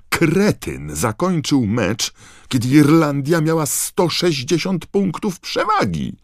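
high, loud indignant rant; furious outburst. Acted. Ron fumes at the player who ended the match, shouting.

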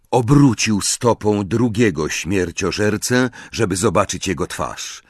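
ordinary delivery

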